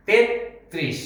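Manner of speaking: তেত্রিশ as "tet... trish", t held; correct doubled-t form. Shown as a demonstration. Teacher modelling তেত্রিশ for learners, tet-trish with doubled t. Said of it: "তেত্রিশ হবে না".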